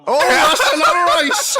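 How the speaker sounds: high, laughing delight. Real, not acted. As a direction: giggle through the words. Giggling at second ace reveal.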